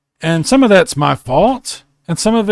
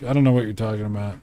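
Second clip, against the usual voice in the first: rattled off quickly, low and soft; fast mock denial; 4 semitones lower, 6 dB quieter, much faster than usual. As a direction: brush it off fast. Playful denial after ban joke.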